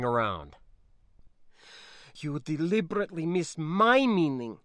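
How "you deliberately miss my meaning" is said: put-on restrained, accented reproach; contained anger, slightly raised pitch; quietly angry. Niece accusing spacer of missing meaning, quietly angry. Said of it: "she replied quietly, angrily".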